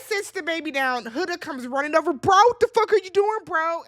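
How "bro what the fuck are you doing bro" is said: higher, louder imitation of an indignant confrontation; mock outrage. Huda rushing over to scold Chris about the baby, mock outrage. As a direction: act out someone storming over in protest.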